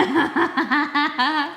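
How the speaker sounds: high giggle; a short bright giggle; laughing. Real, not acted. Laugh heard.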